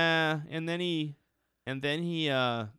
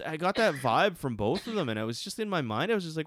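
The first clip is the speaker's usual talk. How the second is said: ordinary talk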